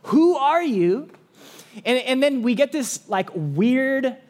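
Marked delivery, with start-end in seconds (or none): 0.0-1.1 higher and louder, slow challenge; pressing question. Retold or imitated. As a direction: demand to know who he is.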